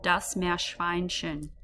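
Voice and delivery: "Meerschweinchen" very slowly, parts joined; slow teacher model; slow and careful. Shown as a demonstration. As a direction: piece the word together slowly. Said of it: "made up of about three parts".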